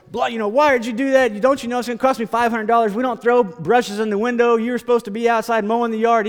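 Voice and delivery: loud, high scolding of a son, fast in places; furious father. Retold or imitated. Preacher voicing a father yelling at his son over the window, loud and angry. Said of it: "his father was furious".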